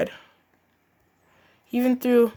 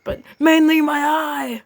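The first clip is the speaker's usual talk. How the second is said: whiny character voice; 7 semitones higher, 4 dB louder than usual. Acted. Character complains about eye.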